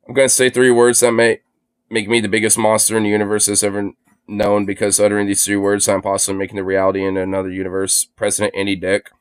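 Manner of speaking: low, level, grave monotone; mock-solemn announcement. Streamer warning of three monstrous words, mock-solemn. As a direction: deliver it like a weighty confession, low and measured.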